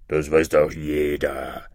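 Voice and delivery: low, slow monster bird; deep, know-all beast; dismissive griffin. Acted. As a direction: brush it off in a deep, drawn-out creature voice. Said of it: "sagte der Vogel Greif".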